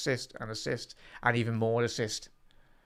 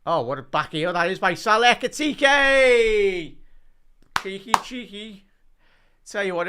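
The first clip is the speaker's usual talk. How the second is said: higher, louder live commentary, building on the back heel; live play-by-play; 6 semitones higher, 5 dB louder than usual. Real, not acted. Fan streamer calls the near-goal to his chat, excited. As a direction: call the near miss as it happens, thrilled.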